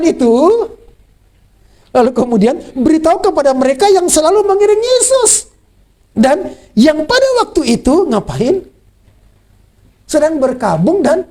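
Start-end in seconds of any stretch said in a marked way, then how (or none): 1.9-5.5 reading scripture aloud. Acted. Preaching the scripture line.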